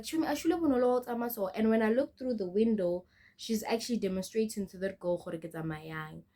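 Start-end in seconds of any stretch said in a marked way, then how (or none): 0.0-1.5 quick, higher imitation of a bully; mock a classmate to a friend, fast and raised; mimicked mocking. Bully passing by tells her friend about the girl, mimicked mocking.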